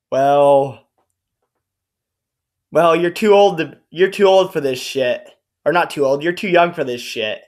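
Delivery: ordinary talk, nothing marked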